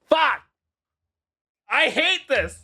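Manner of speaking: high-pitched comic exasperated yell; embarrassed streamer's high comic rant; frustrated outburst. Real, not acted. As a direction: blame the raider in high, mock-furious cries. Slipped secret on stream.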